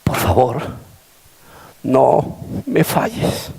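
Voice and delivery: slow, soft, broken pleading; tearful plea. Retold or imitated. Preacher kneeling before his bride, begging her tearfully. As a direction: beg her slowly through tears. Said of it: "llorando le dije".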